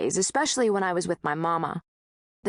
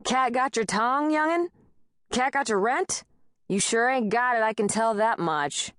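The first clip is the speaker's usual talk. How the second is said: much higher Peckerwood taunt, sing-song; taunting landlord; 5 semitones higher than usual. A narrator's reading. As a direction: needle her like a bully.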